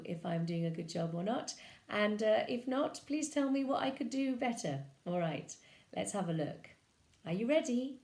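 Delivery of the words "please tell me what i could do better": usual speaking voice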